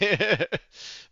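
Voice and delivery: a high, falling burst of laughter; amused cackle. Real, not acted. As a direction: let out a quick mocking laugh. Laughing at own joke.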